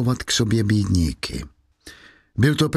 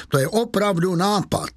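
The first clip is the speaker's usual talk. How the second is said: much higher, sarcastic exclamation; mocking scorn; 8 semitones higher than usual. A narrator's reading. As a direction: jeer with a raised pitch. Javert ridicules the request.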